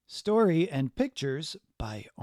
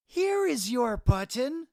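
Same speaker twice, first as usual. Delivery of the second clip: Frog's high, hopeful offer; hopeful call; 10 semitones higher than usual. A narrator's reading. Frog shows Toad a button, hopeful and high. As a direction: call it out brightly, much higher. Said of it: "said Frog".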